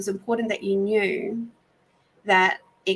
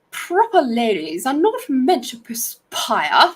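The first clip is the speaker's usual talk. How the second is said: prim, prissy lady voice, slow and a bit louder; prim proper lady; 4 semitones higher, 6 dB louder than usual. Retold or imitated. Teasing a co-worker on a break, mock proper lady voice. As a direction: put on a mock posh lady. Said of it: "You sound so proper when you speak like that".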